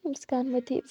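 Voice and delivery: plain reading voice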